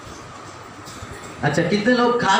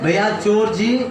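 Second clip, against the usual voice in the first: lower, meek, overly polite begging; mock polite plea; 7 semitones lower than usual. Homeowner politely begging a thief to leave, mock meek. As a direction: beg the thief nicely, a little lower. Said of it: "और आप बोल रहे हैं".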